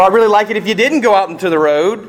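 put-on fast, high, overly sweet request to a child; mock polite request. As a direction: pretend to coax your kid nicely.

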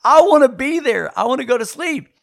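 high, loud mock whine; pleading whine. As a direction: whimper like a tired child begging. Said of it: "I'm going".

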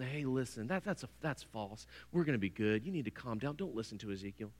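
soft, low murmur luring a leader away from the warning; seductive hush. Retold or imitated. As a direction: lean in close and coax softly. Women coaxing leaders, stroking hair.

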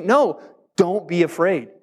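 ordinary talk, nothing marked

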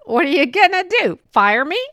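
loud, high mock defiance; defiant teacher. Teacher dares the principal to fire her, loud and cheeky.

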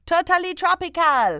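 put-on lilting accent from the Lilt advert. Host quoting the Lilt advert to her friend, in its accent. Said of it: "you have to say it in that accent".

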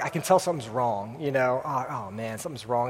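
low, soft voice of a hygienist finding something wrong; hushed worried hygienist. Retold or imitated. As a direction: play the hygienist leaning in, quietly concerned. Dentist staff spotting a problem.

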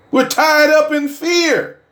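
high, slowed cry of tied up in fear; preaching cry. Acted. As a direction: hit it hard and high. Preaching climax repeated.

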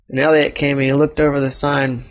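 usual speaking voice

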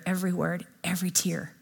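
breathy whisper, no voice. Real, not acted. Pastor whispers to the congregation about tears. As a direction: hush it like a tear falling.

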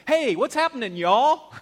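a loud, high, hammed-up Southern "Hey, what's happening, y'all?"; Southern drawl. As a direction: belt it out like a good old Southern boy. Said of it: "That's a different dialect, a Southern dialect".

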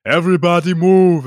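loud, big gentle giant call; booming giant. Retold or imitated. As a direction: call out like a giant clearing a crowd. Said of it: "He's just like".

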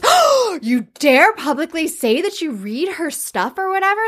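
put-on high, outraged old-lady voice; scandalized grandmother. Grandmother scandalized at Mina's choice of book, outraged. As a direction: clutch the pearls in horror. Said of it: "And the grandmother goes".

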